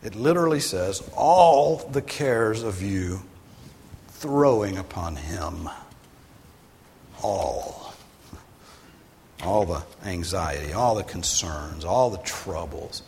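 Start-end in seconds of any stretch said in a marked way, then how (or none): 1.2-5.7 "All" hit hard, the phrase drawn out slow; slow, weighty reading leaning on "All"; emphatic all. Shown as a demonstration. Preacher reading the Greek order, stressing "All". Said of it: "to make the word all emphatic". 9.4-13.0 each "all" punched at the head of the phrase; stress on all. Shown as a demonstration. Preacher listing cares, punching each "all". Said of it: "The all part is emphatic".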